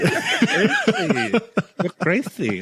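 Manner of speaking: very high, giggly mock Mexican accent; high, laughing put-on Mexican accent. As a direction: squeal it in a hammed-up Mexican accent. Trading mock Mexican accent lines.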